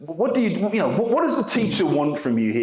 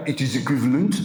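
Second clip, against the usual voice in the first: put-on French accent; 2 semitones lower, faster than usual. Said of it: "basically by speaking French in English".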